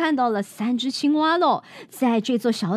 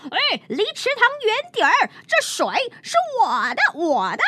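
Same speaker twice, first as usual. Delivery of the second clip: mock yell; 9 semitones higher than usual. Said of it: "米尔顿他就大喊".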